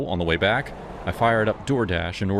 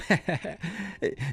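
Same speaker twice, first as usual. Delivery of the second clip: creepy giggle; 12 semitones higher, 6 dB quieter than usual. Acted. Creepy guy laughs.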